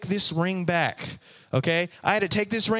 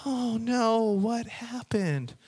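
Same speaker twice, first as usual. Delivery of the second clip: sympathetic clerk; 5 semitones higher, much slower than usual. Retold or imitated. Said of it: "She goes".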